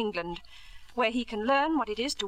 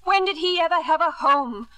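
raised, scornful voice; bitter outburst; 3 semitones higher, 4 dB louder than usual. Acted. Wife attacking husband's idea of home, bitter raised voice. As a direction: throw the word home back at him.

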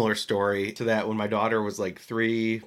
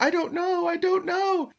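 put-on much higher whiny child voice; high little-girl wail; imitating crying toddler. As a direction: squeak it like a tearful little girl.

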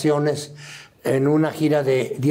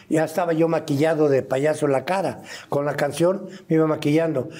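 usual speaking voice

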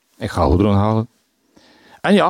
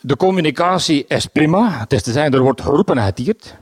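usual speaking voice

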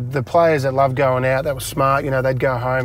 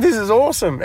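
higher-pitched thrilled reaction; retold excitement, voice lifted; excited delight; 9 semitones higher, 3 dB louder than usual. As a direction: let the good news lift you. Retold delight at friend's news.

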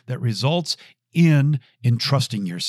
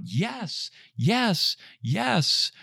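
high-pitched, held-back cheering; mock crowd yell; 9 semitones higher, much slower than usual. Crowd cheers on the wheelbarrow stunt, mock yell. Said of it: "they yelled out".

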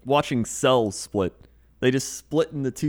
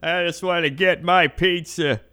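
put-on grumpy old man, pitched up; hammed-up old-timer complaint; cranky old man; 4 semitones higher than usual. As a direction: gripe like a fussy old codger. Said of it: "Krender and I are official old men".

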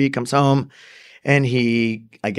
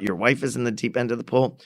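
usual speaking voice

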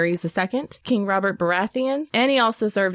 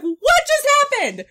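much higher and louder, stunned disbelief, slowing on the last part; shocked outcry; 3 dB louder than usual. Retold or imitated. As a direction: cry out in shock at the TV. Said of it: "sorry, that got loud".

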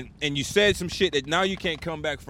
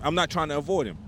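usual speaking voice

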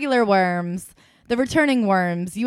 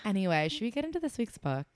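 ordinary talk

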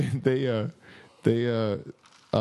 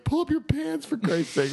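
exasperated yell; 14 semitones higher than usual. Retold or imitated. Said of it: "We're like".

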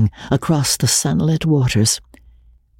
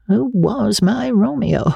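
lightly voiced old woman wondering; 10 semitones higher than usual. Old Magnolia wondering aloud.

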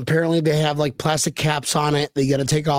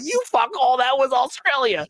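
mock outrage; 9 semitones higher than usual. Retold Brit mocking the accent.

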